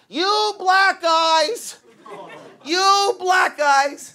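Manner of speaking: high, slow mock yell of an old guy; Steve yelling. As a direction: stretch it out high like a frantic bar manager. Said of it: "He'd be like".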